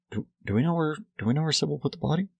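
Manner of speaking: feigned casual asking. Retold or imitated. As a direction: fish for the answer innocently. Hungry vampire feigns innocent question.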